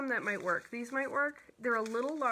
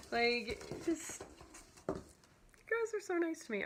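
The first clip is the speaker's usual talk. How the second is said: choked up; 3 semitones higher than usual. Real, not acted. Crafter thanking viewers for kindness, choked up. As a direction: struggle to get words out through real tears. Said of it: "I'll calm down".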